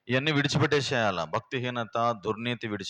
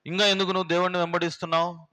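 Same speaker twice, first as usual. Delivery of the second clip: higher, rising to a fast angry outburst; preacher acting Job's wife reproaching him, rising anger; Job's wife scolding; 4 semitones higher, faster than usual. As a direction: voice the bitter wife railing at her husband. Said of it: "దేవుణ్ణి తిడుతుంది ఆయమ".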